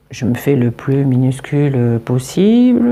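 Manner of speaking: ordinary delivery, nothing marked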